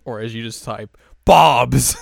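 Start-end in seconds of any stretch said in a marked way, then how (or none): 1.2-2.0 shouted word; loud. Retold or imitated. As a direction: blurt it loud. Reading typed caps aloud.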